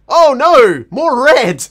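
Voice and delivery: fake-panic at the red; mock alarm. Reacting to blood on screen, loud mock alarm.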